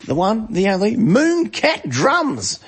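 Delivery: high, drawn-out showman build on the name; hype introduction. Acted. Host hypes up a friend's drum channel, showman style. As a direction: sell the name like a ring announcer.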